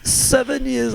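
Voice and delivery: a botched mock British accent. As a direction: give up halfway through a British accent. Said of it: "but in a British accent?".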